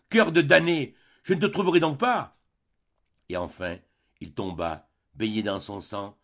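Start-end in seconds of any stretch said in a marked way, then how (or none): 0.1-2.4 much higher, louder anguished shout; desperate cry. A narrator's reading. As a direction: scream it in despair. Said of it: "en criant".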